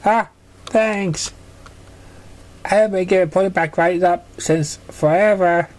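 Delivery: put-on high, childlike and hurt; high young filly voice, a bit sulky; little filly voice. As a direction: play the small filly telling on a bully.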